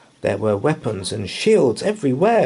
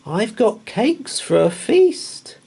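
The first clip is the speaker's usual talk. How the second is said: high coaxing call; 13 semitones higher, 3 dB louder than usual. Acted. Boy tempts the hidden thief, calling high and bright. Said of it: "he called".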